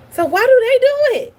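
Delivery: high, loud exasperated question; exasperated outburst. Real, not acted. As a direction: throw it up in frustration.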